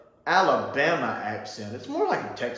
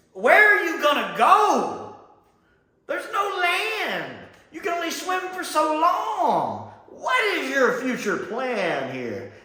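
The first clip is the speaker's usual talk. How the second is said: incredulous; 12 semitones higher, slower than usual. Real, not acted. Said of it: "incredulous rant at sailors".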